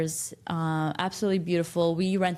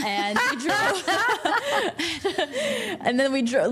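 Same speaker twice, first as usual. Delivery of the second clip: higher-pitched, helpless laughter through the words; laughing; 10 semitones higher, 3 dB louder than usual. Real, not acted. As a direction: try to carry on while cracking up. Laughing after quack quack duck joke.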